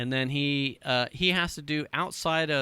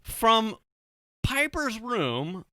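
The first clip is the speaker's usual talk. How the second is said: usual speaking voice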